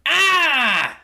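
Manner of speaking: high, loud delighted squeal; Snowdrop's excited happy yelp; cheerful shriek. Acted. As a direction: squeal with joy at seeing friends. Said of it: "Snowdrop cheered".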